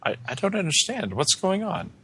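high, softly bewildered concern; acted worry, pitched well up. Detective questions the passenger about the stabbing, concerned. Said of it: "he looks concerned".

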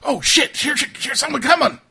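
high, loud, rushed panic; startled alarm. Acted. Game master as guards spotting an intruder, startled alarm. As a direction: blurt it out fast to warn the others. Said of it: "they're like".

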